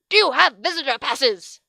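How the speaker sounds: a loud, screeching demand; the teacher screams at the visitors again. A narrator's reading. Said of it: "Miss Blanchette screamed again, only this time it was louder".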